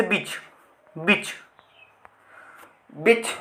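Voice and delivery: बीच said short, as bich with a quick i; short vowel. Shown as a demonstration. Teacher showing students another short sound, short i in बीच. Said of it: "हमें ज़्यादा sound देने की ज़रूरत नहीं पड़े".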